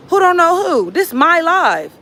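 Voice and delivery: loud, high, indignant comeback; real indignation. Real, not acted. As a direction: fire back, affronted and loud. Indignant comeback to a live commenter.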